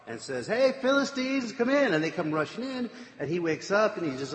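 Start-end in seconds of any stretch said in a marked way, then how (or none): 0.5-1.9 high, raised retold call. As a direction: summon waiting men with a high mock shout. Delilah calling the Philistines in.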